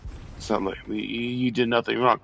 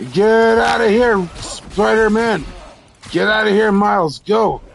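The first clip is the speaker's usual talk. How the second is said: loud, higher frustrated shouts; yelling at enemies; 7 semitones higher, 4 dB louder than usual. Real, not acted. Gamer screaming at enemies swarming Miles, loud yell. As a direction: scream them off you.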